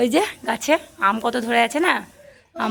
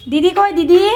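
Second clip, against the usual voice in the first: loud, raised-pitch call; 6 semitones higher, 11 dB louder than usual. Real, not acted. Mother asks small child where sister is, calling out. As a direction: call out, higher and much louder.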